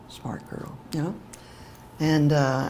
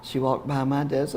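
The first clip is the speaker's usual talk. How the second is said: ordinary delivery, nothing marked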